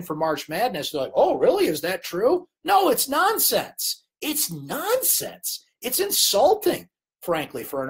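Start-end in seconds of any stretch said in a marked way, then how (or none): 1.1-2.5 mock surprise. Mimics clueless March Madness fans. 2.6-6.9 slow, high-pitched exasperation; frustrated. Real, not acted. Host rejecting insulting commentary, frustrated. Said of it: "that is so frustrating".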